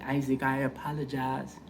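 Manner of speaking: put-on soft, smooth African-accented father; attempted African accent. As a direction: imitate an African dad apologizing gently. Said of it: "I can't, my African accent".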